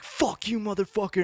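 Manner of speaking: high-pitched retold outburst; Jim. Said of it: "Jim's like".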